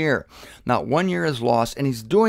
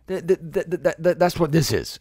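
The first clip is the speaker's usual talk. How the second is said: real excitement, tripping repeatedly on the first word; excited stutter; 3 semitones higher than usual. Real, not acted. Preacher carried away by his point, excited stammering. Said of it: "sorry, I'm getting excited".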